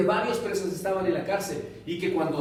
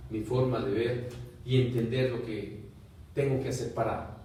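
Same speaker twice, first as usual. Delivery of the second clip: closing prayer; 7 semitones lower, 4 dB quieter than usual. Acted. Preacher leads the congregation in prayer: soft, humble, low. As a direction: pray humbly, barely above a murmur.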